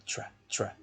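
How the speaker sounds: tra whispered, quiet; stressed syllable shown. Shown as a demonstration. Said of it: "a sílaba tônica é a primeira". (sussurrando a sílaba tra)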